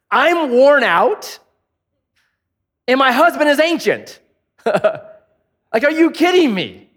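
high, incredulous Sarah voice with laughter; scoffing disbelief. Retold or imitated. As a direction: scoff at the idea like she's too old.

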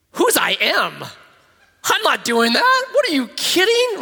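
high, loud disbelief with a laugh; laughing, squeaky disbelief at the name; incredulous Moses. Retold or imitated. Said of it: "And Moses goes".

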